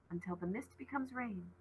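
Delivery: breathy whisper, barely there; whispered thought. A narrator's reading. Ollie whispering a thought to herself, hushed. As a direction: whisper the words to yourself.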